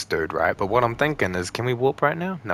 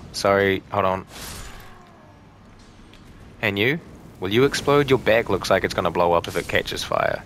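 normal talking voice

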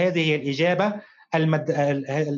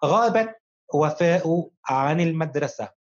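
slow reading; slower than usual. Shown as a demonstration. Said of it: "نقرأ معًا".

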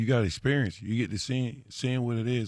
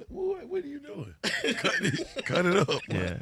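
high, laughing plea to get on with it; half laugh, half complaint; laughing exasperation; 9 semitones higher, 3 dB quieter, slower than usual. Retold or imitated. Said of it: "I'm like".

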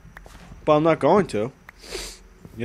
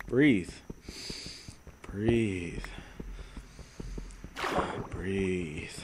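softer and lower each time; breathe; 8 semitones lower, 15 dB quieter, much slower than usual. Pretend. Streamer telling himself to breathe, fading. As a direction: fade out, calming.

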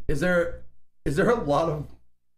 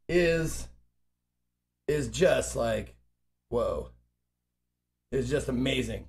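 awed; 7 semitones lower, 4 dB quieter, slower than usual. Real, not acted. Awed tasting.

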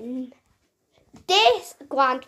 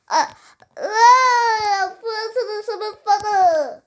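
tearful joy; 5 semitones higher, 3 dB louder than usual. Pretend. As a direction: sob it out happily. Yoshi reacts to winning.

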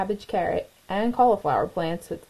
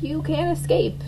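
higher, menacing crow; screeching crow; 8 semitones higher than usual. A narrator's reading. The crow chases Katisha, higher and menacing. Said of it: "screeched the crow".

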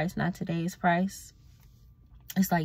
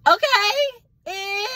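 much higher, loud indignant yelp; incredulous cry; 10 dB louder, much slower than usual. Real, not acted. After coworker asks about her day off, loud incredulous high yelp. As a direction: cry out in disbelief.

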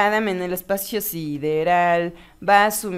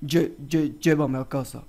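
very low, softer stuttering voice; voz profunda tartamudeante; 7 semitones lower, 5 dB quieter than usual. Acted. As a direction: stammer in a put-on deep voice. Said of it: "tartamudeante que suena extrañamente profunda". (voz grave fingida, con tartamudeo)

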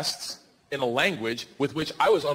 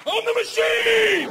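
much higher, louder, slow triumphant shout; shouted boast; 6 dB louder, much slower than usual. Retold or imitated. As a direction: bellow it like a battle cry. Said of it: "Fuck it".

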